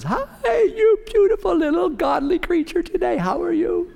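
very high sugary falsetto gush; mock a gushing church lady, way up high; hammed-up sweet lady voice, high falsetto. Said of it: "this lady comes down and says".

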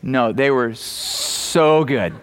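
slow and savoring, "so" stretched long; wife gushing over lemon bars, slow stress on "so"; drawn-out so. Retold or imitated. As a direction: stretch "so good" like she's still tasting it. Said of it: "She goes".

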